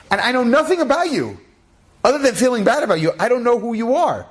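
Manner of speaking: high-pitched, acted disbelief; incredulous. Pharmacist to a stranger he trusted, incredulous. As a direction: marvel at your own rash trust.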